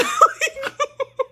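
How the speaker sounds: high burst of laughter. Real, not acted. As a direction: laugh at the memory. Laughing at own story.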